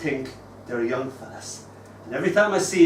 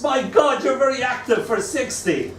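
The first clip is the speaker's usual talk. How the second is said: put-on amazed child; 9 semitones higher than usual. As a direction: blurt it out in disbelief. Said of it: "He gasps".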